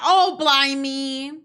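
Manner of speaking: slow, loud mock-British exclamation; British accent. Shown as a demonstration. Doing a British accent on request, hammed-up exclamation. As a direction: put on your best British accent, drawn out. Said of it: "Do your best British accent".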